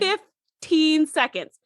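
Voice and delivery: fifteen seconds drawn out slowly; slow emphasis. Shown as a demonstration. Podcaster stressing how short the time is, slow. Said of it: "Fifteen seconds went by with me saying".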